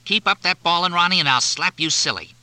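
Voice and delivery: loud, high scolding yell; shouting mother. A narrator's reading. Mother yelling at crying Ronnie, loud scolding. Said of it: "a woman's voice shouted".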